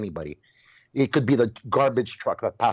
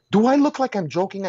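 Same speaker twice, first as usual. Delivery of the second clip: high, louder, mock-offended; mock indignant retort; 9 semitones higher, 4 dB louder than usual. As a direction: toss back a high indignant question. Retold retort to the lady.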